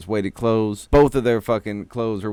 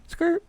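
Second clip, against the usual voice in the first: tire screech. Retold or imitated. Raditz peels off.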